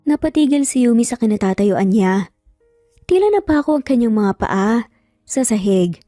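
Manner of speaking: ordinary delivery, nothing marked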